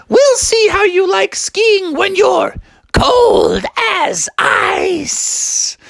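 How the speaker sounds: very high falsetto, slowing on the threat; menacing mom. Acted. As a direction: draw out a shrieky mother's threat.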